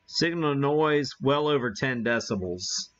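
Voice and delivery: normal talking voice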